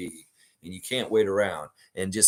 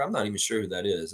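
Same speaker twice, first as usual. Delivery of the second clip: usual speaking voice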